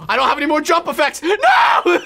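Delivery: yelling in dismay mid-fight. Real, not acted. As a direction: panic as the advantage vanishes. Losing jump potion, falling.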